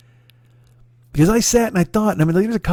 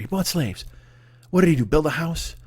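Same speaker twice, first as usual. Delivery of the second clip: usual speaking voice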